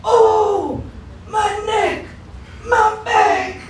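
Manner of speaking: put-on high, strained old granny voice; creaky grandma complaining about her aches; old lady groan. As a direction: whine like an achy grandma. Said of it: "I'm gonna be like".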